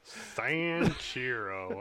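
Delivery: Sanshiro drawn out very slowly, Texan style; Texan accent. Retold or imitated. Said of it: "this super Texan version of the word".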